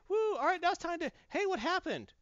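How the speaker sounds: high excited cheer turning confused; cheer, then sudden confusion; mock cheering. Acted game characters cheering, then confused.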